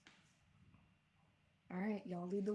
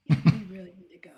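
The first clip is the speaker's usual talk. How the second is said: quick, voiceless whisper; urgent whisper; 18 dB louder, much faster than usual. Real, not acted. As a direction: whisper it fast so nobody overhears. Hiding from monsters, trying to be quiet.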